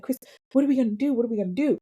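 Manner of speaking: mock a sister starting to panic; imitated freaking out. Sister asking brother for a plan, freaking out. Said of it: "Brittany's freaking out".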